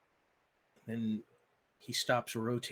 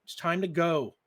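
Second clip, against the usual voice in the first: firm refusal; 5 semitones higher, 10 dB louder than usual. Acted. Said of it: "he says".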